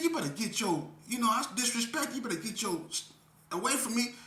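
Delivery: high, loud threat; angry warning. Retold or imitated. Speaker warning the woman off, loud and heated. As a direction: warn her off, heated. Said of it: "I said".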